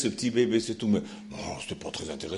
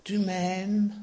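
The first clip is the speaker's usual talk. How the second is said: put-on Swiss accent; 6 semitones higher than usual. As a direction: ask it gently in a Swiss accent. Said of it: "Je prends mon accent suisse".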